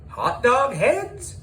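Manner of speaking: disbelieving echo. A narrator's reading. Teacher's astonished echo.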